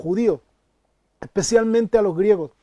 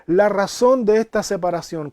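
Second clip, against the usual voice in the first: usual speaking voice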